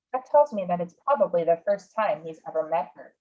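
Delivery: ordinary delivery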